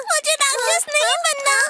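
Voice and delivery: high-pitched tearful wail; crying. Acted. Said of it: "रोना बंद करो".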